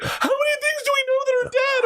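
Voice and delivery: extremely high, wailing child imitation; hammed-up hysterical kid shriek; mock meltdown. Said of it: "And they melt down".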